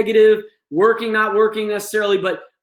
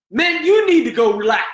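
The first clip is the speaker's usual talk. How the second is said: higher and louder mocking snap back; angry comeback; 7 semitones higher, 5 dB louder than usual. Shown as a demonstration. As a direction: throw it back at the staff. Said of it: "the individual in crisis now".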